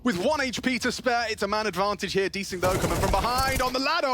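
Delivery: high, loud frantic hype; esports caster. Real, not acted. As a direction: shout the clutch play by play. Clutch moment called live.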